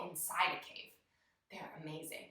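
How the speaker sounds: awed whisper. Real, not acted. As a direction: whisper it like a secret wonder. Whispers awe about caves.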